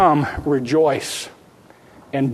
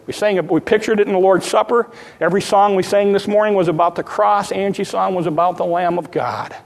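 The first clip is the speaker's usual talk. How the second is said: normal talking voice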